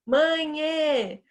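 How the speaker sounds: put-on high, drawn-out child's call for mother; calling to mom from another room. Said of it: "desde pequena a gente chama".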